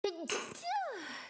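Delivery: sudden high sneeze; sneezing. Real, not acted. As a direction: let out a real sneeze. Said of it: "I need to sneeze".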